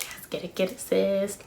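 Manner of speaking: whisper, get it sis; hush-cheer your friend on; inner cheering whisper. Retold or imitated. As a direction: silent cheering in her head, whispered. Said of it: "in my head mentally like".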